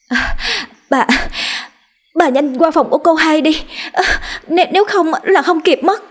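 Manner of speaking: high, breathless stammering plea; panicked servant. Acted. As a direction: rush her out the door, voice up high. Servant panicking, urging mistress.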